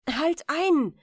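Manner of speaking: loud, high command to stop; shout. A narrator's reading. Rat shouts at the queen to stop, loud. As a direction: cry out to stop the throw. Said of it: "schrie die Ratte".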